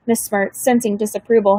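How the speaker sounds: ordinary delivery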